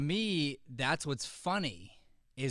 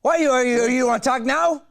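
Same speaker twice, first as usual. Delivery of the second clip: high, loud and wired; still manic high shout; 11 semitones higher, 12 dB louder than usual. Shown as a demonstration. Teacher still in character, answering her scene partner shrilly. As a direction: snap back, still in the crazy voice. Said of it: "crazy and weird".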